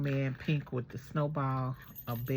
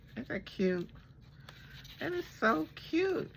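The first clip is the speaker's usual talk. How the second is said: excited squeal, much higher; 13 semitones higher than usual. Real, not acted. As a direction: squeal it out with delight. Squeals at the ornament.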